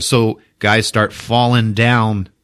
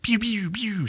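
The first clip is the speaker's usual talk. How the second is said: mock gunfire; 12 semitones higher, 10 dB quieter than usual. Retold soldiers shooting.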